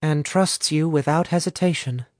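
plain reading voice

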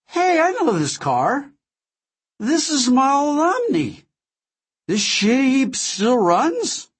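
put-on high, slow, slurred drunk voice; woozy and slurring, half-conscious; town drunk. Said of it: "my father drooled and mumbled".

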